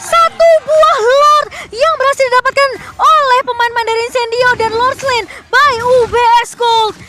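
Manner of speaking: loud high shouted play-by-play; esports caster. Real, not acted. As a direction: yell the Lord steal call. Play-by-play of Lord steal.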